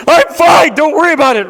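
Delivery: yelling that he's fine while bolting out; frantic yell. Retold or imitated. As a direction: shout it high and loud, brushing her off. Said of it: "I'm like".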